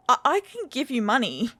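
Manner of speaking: sputtered bargaining, voice pushed up in volume; sputtering. A narrator's reading. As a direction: stammer out a desperate offer. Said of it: "I sputtered".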